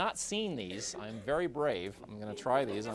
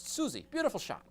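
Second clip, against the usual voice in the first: director's squawk; 10 semitones higher than usual. Retold or imitated. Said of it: "puts on his squawk".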